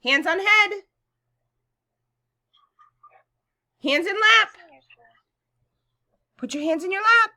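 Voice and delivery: bright, loud classroom voice; kindergarten teacher voice. Acted. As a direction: lead little kids cheerfully. Teacher leads class routine.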